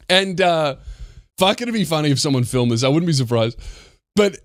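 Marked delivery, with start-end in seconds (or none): none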